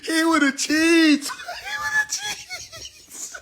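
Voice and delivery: shrieking laughter over the words; laughing hard. Real, not acted. Comic cracking up over a cheesing rapper, laughing hard. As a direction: barely get it out through laughter.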